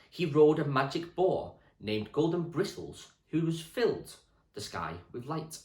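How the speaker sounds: put-on steady storybook reading for children; reading aloud to class. Teacher reading a history book to Year six, storybook voice. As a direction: read the book to the class clearly.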